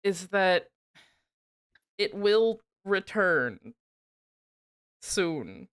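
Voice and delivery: ordinary talk, nothing marked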